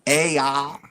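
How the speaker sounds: put-on a light southern accent, barely there. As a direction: drawl a quick southern greeting. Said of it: "Do a southern accent".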